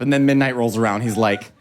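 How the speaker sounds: ordinary delivery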